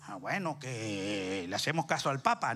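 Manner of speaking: sheepish answer. Retold or imitated.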